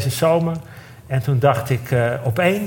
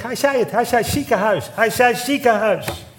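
overjoyed disbelief; 10 semitones higher than usual. Retold or imitated. Overjoyed friend finally speaks.

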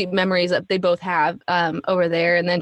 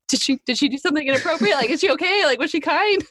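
much higher, fast, worried dad; nervous dad; 8 semitones higher, faster than usual. Retold or imitated. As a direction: rush it out like a flustered father. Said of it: "And he's like".

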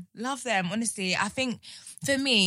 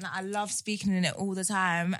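ordinary delivery

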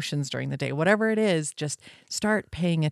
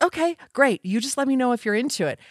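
higher, quick easygoing okay; breezy friend; 7 semitones higher, faster than usual. Retold or imitated. Imitating the friend accepting the delay, higher and breezy. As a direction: toss it off like the cheerful friend. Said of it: "because she's like".

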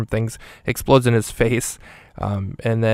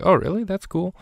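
much higher, breezy cartoonish voice; Michael Pena voice; 9 semitones higher than usual. Hitman reacting to Nick's answer, high breezy mock voice. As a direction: toss it off high and impressed. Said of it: "he's like".

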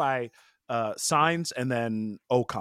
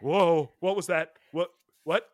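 mock surprise; 6 semitones higher than usual. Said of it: "I was like".

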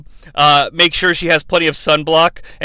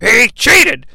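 mock outraged wrestling manager, higher and louder; Heenan ranting; 6 semitones higher, 5 dB louder than usual. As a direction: ham up a heel manager whining that Hogan cheated. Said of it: "be like".

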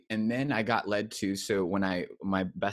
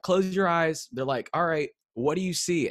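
leader instructing; 8 semitones higher, 4 dB louder than usual. Retold or imitated. Camp leaders instructing the room.

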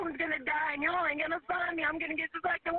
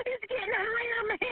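crying in fear; 6 semitones higher than usual. Real, not acted. Trapped caller panicking.

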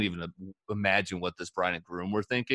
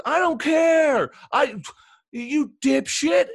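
imitating a furious broadcaster, much higher and louder; angry outburst; 8 dB louder, slower than usual. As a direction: scream it at the producer, slow and high. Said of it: "Like, he says".

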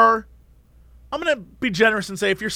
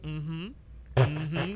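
bored murmur; 5 semitones lower, 11 dB quieter than usual. Retold or imitated. Imitating bored cashier.

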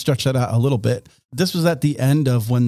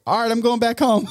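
high, laughing, breezy exit; imitated settler; 10 semitones higher than usual. A settler bails on the colony, high and laughing. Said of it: "he was like".

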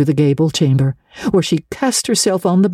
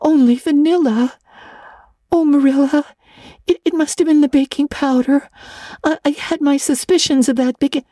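put-on stammering girl; 13 semitones higher than usual. A narrator's reading. Anne mortified, stammering.

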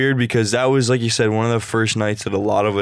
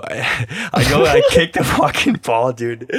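laughing; 2 semitones higher, slower than usual. Real, not acted.

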